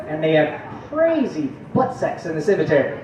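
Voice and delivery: ordinary delivery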